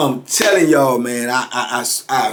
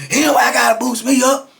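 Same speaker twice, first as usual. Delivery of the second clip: high, insistent angry retort; retold outraged comeback; imitated indignation; 7 semitones higher than usual. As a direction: insist hotly, voice pitched right up. Customer answers back angrily.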